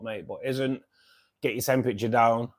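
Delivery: ordinary talk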